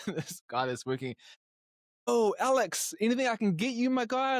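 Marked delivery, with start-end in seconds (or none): 2.1-4.4 imitating the salesman, much higher and louder; chirpy overeager salesman voice, pitched way up; eager shop assistant. To the brother in the changing room, an overeager salesman voice.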